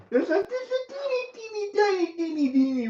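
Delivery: put-on squeaky baby-talk, dropping down; cutesy high voice. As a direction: coo it like to a baby. Cute tiny amount talk.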